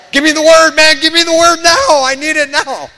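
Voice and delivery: loud, high, pleading demand for the word; craving shout. Retold or imitated. Churchgoer desperate for the word, loud pleading cry. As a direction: beg loudly like an addict needing a fix.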